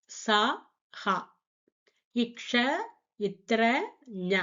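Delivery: every syllable from க to ஞ said slowly one by one; full Hindi consonant recital. Shown as a demonstration. Teacher to students, reciting the whole chart again from the start. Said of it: "நான் சொல்லி தந்துடுறேன்".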